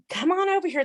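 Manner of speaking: high, sing-song call from the barn doorway; teasing call. Retold or imitated. Husband teasing his scared wife from the barn, high sing-song call.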